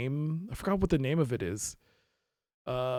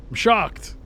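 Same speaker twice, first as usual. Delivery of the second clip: I'm shocked, jumps higher and louder; hammed-up gasp of shock; mock shock; 5 semitones higher, 5 dB louder than usual. Shown as a demonstration. Streamer repeats the line, now hamming up the shock.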